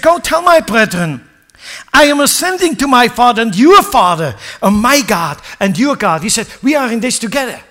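high, loud preaching build; fiery preaching. Acted. Preacher driving the story home to the congregation, fiery. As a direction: preach it out to the church.

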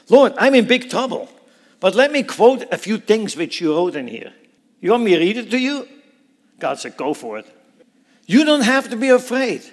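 loud, high, urgent praying; bold prayer. Acted. A believer in trouble prays boldly: loud, urgent. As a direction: talk straight to God as a friend in trouble. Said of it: "Have you ever talked like this to God?".

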